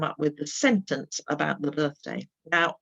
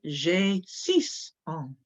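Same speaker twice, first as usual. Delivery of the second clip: slow model answer; much slower than usual. Shown as a demonstration. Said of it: "you say".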